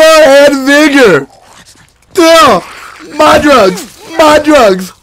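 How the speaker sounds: hammed-up screams over his drugs, high and loud; mock screaming. Gamer hamming up a panic over his stash. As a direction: yell it in fake panic as the horde attacks. Recap of a zombie attack.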